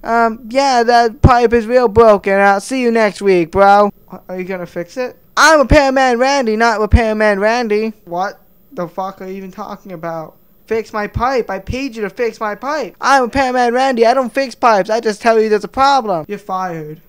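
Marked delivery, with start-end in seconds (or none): 0.0-3.9 higher, louder, slow dopey repairman; lazy repairman voice. Acted. Repairman Randy loudly tells customer about broken pipe. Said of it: "It's like me saying". 5.4-7.9 lazy repairman voice. Acted. Repairman Randy loudly tells customer about broken pipe. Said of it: "It's like me saying". 13.0-16.2 lazy repairman voice. Acted. Repairman Randy loudly tells customer about broken pipe. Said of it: "It's like me saying".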